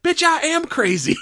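high, hammed-up defiance; imitating a wild retort; mock defiant comeback. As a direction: throw it back as an acted, excitable boast. Retelling Otis's defiant comeback.

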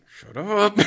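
high-pitched, exasperated retort; imitated fed-up protest; mock exasperated. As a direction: act out shushing a nagging inner voice. Eddie hushing the voice, retold.

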